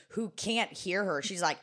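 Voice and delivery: normal talking voice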